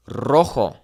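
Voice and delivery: "rojo" slowly with a trilled R; rolled initial R. Shown as a demonstration. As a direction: roll the R in "rojo". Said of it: "So for example".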